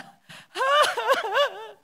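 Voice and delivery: high, loud mock wail; imitated loud sobbing. Preacher imitating a newly widowed woman, loud mock sobbing. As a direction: burst into exaggerated bawling. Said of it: "saya nangis keras".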